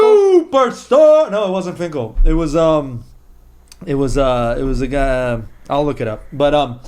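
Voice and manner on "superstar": very high, loud, stretched-out arena call; ring announcer imitation. Fan finishes imitating Edge's entrance call, ring announcer style. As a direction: belt it out as the climax of an introduction. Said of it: "he was like".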